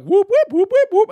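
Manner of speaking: high loud whooping sound effect; stretching legs noise. Acted. Third guy voices his legs stretching with high loud whoops. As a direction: make the legs shoot up and down.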